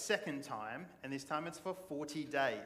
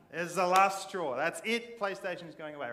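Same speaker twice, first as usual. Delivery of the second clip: loud fed-up parent snapping, higher pitch; exasperated parent; 3 semitones higher, 8 dB louder than usual. Retold or imitated. Parent to kids, fed up and shouting. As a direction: blow up like a parent who's had enough. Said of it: "ever got your parents to that point".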